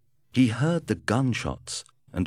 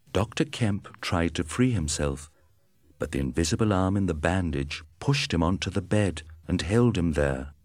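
ordinary delivery, nothing marked

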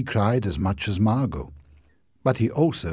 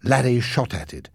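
usual speaking voice

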